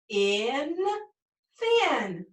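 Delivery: teacher blending "thin" with a short vowel; blended short vowel. Shown as a demonstration. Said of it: "blend that together using that vowel short sound".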